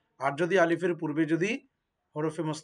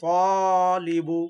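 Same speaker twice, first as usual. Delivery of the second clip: teacher stretching the alif; slow model reading of তালিবু; drawn-out long vowel; 4 dB louder, much slower than usual. Shown as a demonstration. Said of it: "এক আলিফ পরিমাণ টেনে পড়তে হবে".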